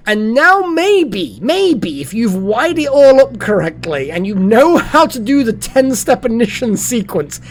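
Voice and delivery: gleeful gamer climax; rising excitement. Real, not acted. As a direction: hype it up like the reactor just lit. Building hype about reactor ignition.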